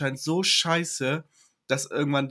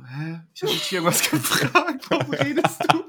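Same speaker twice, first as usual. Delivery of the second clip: mock stoned boyfriend; 3 semitones higher than usual. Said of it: "Ich so".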